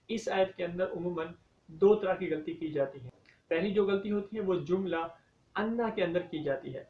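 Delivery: usual speaking voice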